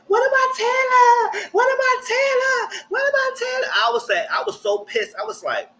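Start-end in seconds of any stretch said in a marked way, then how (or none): 0.1-3.7 high, slow, shouted repeated question; mock clamor. Islanders shrieking at the villa about Taylor, high mock shouting. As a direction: imitate the whole group shrieking the name.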